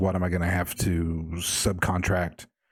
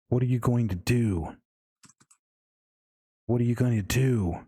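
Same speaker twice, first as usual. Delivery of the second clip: a touch higher, pressing the question with mock anger; mild mock anger; 4 semitones higher than usual. Mother yelling at father, mildly angry, lightly acted. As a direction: let the asking turn sour and angry, lightly. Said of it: "Only now it's starting to seem angry".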